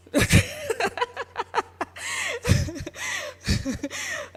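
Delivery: long high laugh at her own joke. Real, not acted. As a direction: burst out laughing and let it run.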